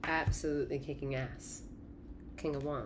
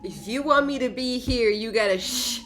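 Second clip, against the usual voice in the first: stern demand; 6 semitones higher, 7 dB louder than usual. Acted. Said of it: "sea of emotions are asking you".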